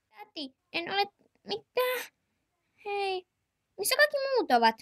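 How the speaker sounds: put-on high, young child's voice calling auntie; little child asking auntie. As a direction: play a small whiny kid.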